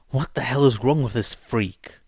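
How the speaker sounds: loud shout full of revulsion; disgusted shout. Acted. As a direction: yell it out, sickened by what he sees. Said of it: "Night Eye shouted in disgust".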